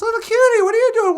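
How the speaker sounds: loud, high start, then quick question; surprised greeting. Acted. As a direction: hail a friend you didn't expect. Fox surprised to meet little cutie.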